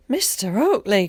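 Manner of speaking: loud glad call up to the window. A narrator's reading. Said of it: "cried the brawny, dark-haired man".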